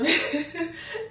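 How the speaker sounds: short amused laugh with a rise; real laugh. Real, not acted. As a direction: let out a real burst of laughter. Laughs recalling the scene.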